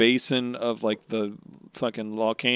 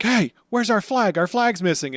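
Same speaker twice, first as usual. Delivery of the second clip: raised loud question; Luffy voice; 9 semitones higher, 5 dB louder than usual. Retold or imitated. Voicing Luffy hunting the flag. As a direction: ask it loudly. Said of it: "he's like".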